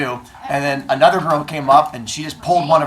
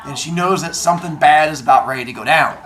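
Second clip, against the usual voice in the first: ordinary delivery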